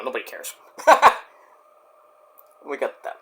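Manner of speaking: ordinary talk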